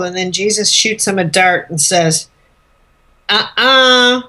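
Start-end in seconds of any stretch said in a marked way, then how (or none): 3.3-4.2 drawn-out, firm negating hum; mock refusal. Jesus contradicting Pilate's claim to power, teasing refusal. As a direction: shut the boast down.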